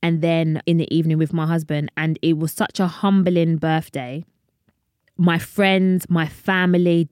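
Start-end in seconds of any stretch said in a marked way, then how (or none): none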